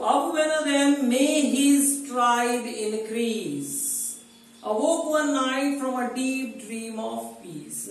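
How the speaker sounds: slow, measured reading of verse; poem recitation. Acted. Teacher reciting opening lines to students, slow and measured.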